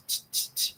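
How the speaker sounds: unvoiced clock ticks; whispered ticking t. Shown as a demonstration. Teacher imitating the clock's ticking, whispered t's. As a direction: tick out the t's in a breathy whisper. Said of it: "this t".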